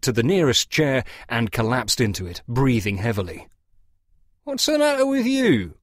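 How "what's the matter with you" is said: much higher, louder farmhand voice; a suspicious farmer calling out a sharp question; Claude's voice. A narrator's reading. Farmer asks the collapsed dealer what's wrong, pitched high and loud.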